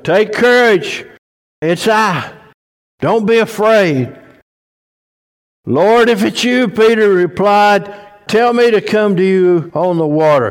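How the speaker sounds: high, loud preaching of the story; full-force preaching. Acted. Preacher telling the church of Peter walking on water, full force. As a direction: preach it with fire.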